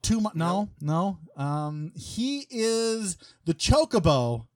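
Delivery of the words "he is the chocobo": slow, high, grand announcement of the co-host; drawn-out intro. Acted. Host introducing his co-host, drawn out and grand. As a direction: stretch each word like a hype man.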